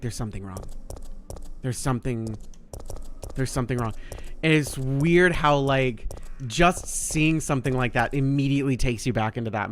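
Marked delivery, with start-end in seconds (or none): 0.0-3.9 hushed and uneasy. Retold or imitated. Scared whisper in quiet game part.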